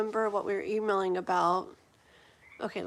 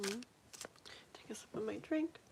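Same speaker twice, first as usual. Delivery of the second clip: whispered aside; 13 dB quieter, slower than usual. Real, not acted. Aside while drinking.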